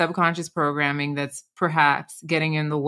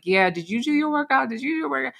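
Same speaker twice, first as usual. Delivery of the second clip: higher-pitched mock buddy check-in; playful competitive nudging; 8 semitones higher than usual. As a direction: teasingly egg on a workout buddy, pitched up. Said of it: "You know, in fun".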